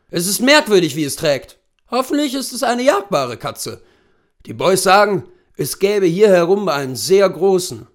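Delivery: put-on brief the client as an old hunting guide; erfahrener Großwildjäger. Voices hunter Wilson in story. (erfahrener Jagdführer, lauter und höher)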